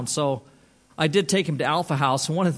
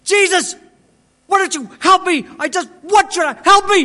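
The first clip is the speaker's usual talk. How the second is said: very high, loud shouted plea; imitating a man at breaking point, shouting; desperate cry to Jesus; 13 semitones higher, 10 dB louder than usual. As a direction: cry out to heaven in acted anguish. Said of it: "he kinda turned away from me".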